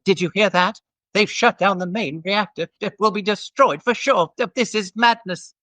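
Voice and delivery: flustered English droid sounding the alarm; C-3PO voice. Retold or imitated. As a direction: panicky prim robot butler, wringing its hands. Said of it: "do the voice with an English accent".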